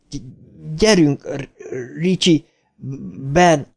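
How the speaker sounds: high, halting stammer; urgent stuttered rallying cry; stuttering. A narrator's reading. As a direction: fight through each blocked first sound. Stuttering boy urges his friends.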